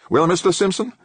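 high-pitched, cutting question; cutting professor. A narrator's reading. Professor demanding an answer from his staffer, cutting. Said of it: "Ulrich's voice cut through his mantra".

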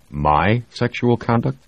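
"My sexual conduct?" slow, rising on "sexual"; disbelieving echo. Acted. Jamie echoes in disbelief.

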